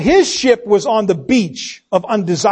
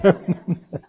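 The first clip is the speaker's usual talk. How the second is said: soft laugh; 3 semitones lower, 4 dB quieter than usual. Real, not acted. Preacher laughs at own joke.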